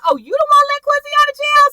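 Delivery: very high, loud incredulous question; hammed-up shocked accusation; mock outraged disbelief. [name] confronting Veronica about Quincy, mock shock.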